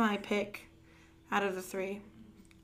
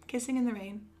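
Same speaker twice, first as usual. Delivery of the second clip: normal talking voice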